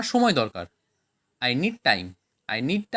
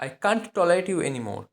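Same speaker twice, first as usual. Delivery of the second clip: slow, word by word; slow dictation; much slower than usual. Shown as a demonstration. Teacher modelling English for Bengali learners, slowly. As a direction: say it slowly so they can follow. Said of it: "teacher models the English slowly".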